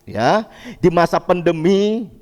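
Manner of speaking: ordinary delivery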